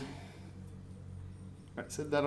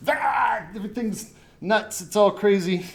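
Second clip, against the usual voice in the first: high-pitched, frazzled panic; frantic panic; 5 semitones higher, 4 dB louder than usual. Acted. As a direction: act overwhelmed by the chaos. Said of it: "okay, so back to being calm".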